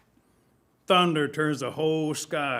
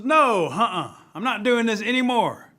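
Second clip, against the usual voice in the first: loud, high protest; defiant refusal; 7 semitones higher, 9 dB louder than usual. Acted. Turtle refusing to be the animals' ball, loudly defiant. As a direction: dig in and refuse, voice raised.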